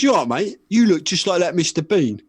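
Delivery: imitating an amused punter; bloke in waiting room. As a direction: call it out to a stranger, loudly. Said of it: "and the guy goes".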